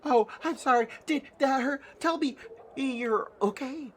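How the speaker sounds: high-pitched, anxious Nanny voice; frightened apology. Acted. Nanny checks on player she slapped, high and alarmed. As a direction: apologize in a worried fluster. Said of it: "still looks shocked and frightened".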